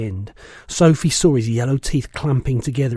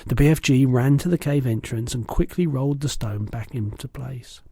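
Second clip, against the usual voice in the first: usual speaking voice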